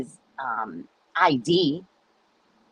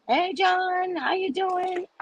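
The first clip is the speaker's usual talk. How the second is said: fake sweet greeting; 13 semitones higher, slower than usual. Fake friendly neighbor greeting.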